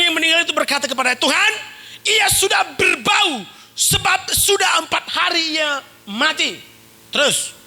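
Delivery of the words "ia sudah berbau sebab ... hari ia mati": Martha's protest. Acted. Martha's objection acted out.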